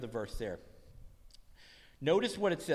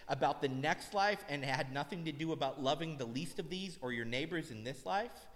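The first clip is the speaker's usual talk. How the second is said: ordinary talk, nothing marked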